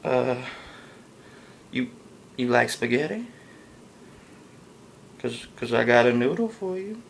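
put-on lightly slurred, halting drunk; a hint of a tipsy guy fumbling; lightly played drunk pickup line. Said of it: "if I'm drunk".

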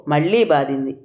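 ordinary delivery

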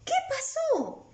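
very high, slow, startled question; demonstrative high-pitched question; questioning cry. Shown as a demonstration. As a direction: ask it high and stretched, as if alarmed. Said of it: "Está preguntando".

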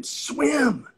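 a high, loud yell to a drowning man; yelling swim. Shown as a demonstration. Yelling at a drowning man to keep swimming. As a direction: bellow it across the water. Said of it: "You're gonna yell at him".

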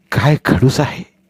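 usual speaking voice

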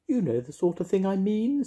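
high, sing-song witchy voice; bright, bossy little witch. Acted. Mavis voiced in story.

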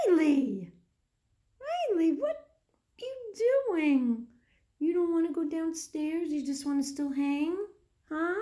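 high, loud playful scolding coo; mock surprise. Real, not acted. Mother playfully calling her baby who turned away, high mock surprise. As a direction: tease her for turning her back.

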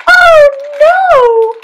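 very high, loud little goat voice; little goat's cry. A narrator's reading. Little billy goat facing the troll, crying out high. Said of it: "cried the billy goat".

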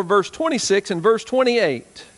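normal talking voice

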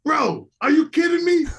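high, loud imitated yell; incredulous yelling. [name] imitating Mike yelling about the early gym, high loud disbelief. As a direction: shout it back like he can't believe it. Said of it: "he just starts yelling".

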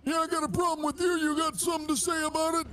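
put-on high, fast feisty old man; old guy voice. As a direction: pick a fight like an old geezer. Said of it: "Old guy voice R P".

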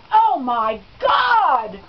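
loud, slow startled exclamation; a drawn-out shout of delight over a Scrabble play; outside voice. Retold or imitated. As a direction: blurt it out way too loud for a hospital room. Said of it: "I had my outside voice inside".

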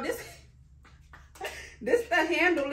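normal talking voice

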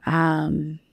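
slow, hushed relaxation guide voice. Acted. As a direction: breathe the words out gently, half-whispered. Guided relaxation, jaw loosening.

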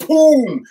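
a sudden high, loud "boom"; explosive boom. Retold or imitated. Priest telling how the girl vomited, with a loud high boom. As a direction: blurt it like something bursting out.

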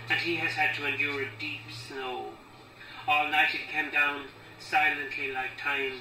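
deliberate recital, pressing on key words; poem reading. Acted. Poet reading about a father's grave, firm deliberate recital. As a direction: recite the verse firmly, pausing between phrases.

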